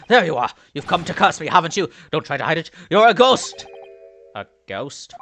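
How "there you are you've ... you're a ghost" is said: loud, rising to a shout on the last words; frantic accusation. Acted. Cornered inmate accusing a ghost, frantic and loud. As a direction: accuse them louder and louder.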